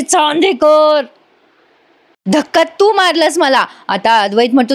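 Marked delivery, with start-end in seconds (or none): 0.0-3.6 a slow, drawn-out name, then a raised accusation; angry accusation. A narrator's reading. Wife accusing her husband of pushing her, angry. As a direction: call his name slowly, then accuse him. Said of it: "कला चिडते आणि म्हणते".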